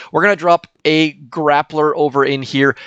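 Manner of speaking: usual speaking voice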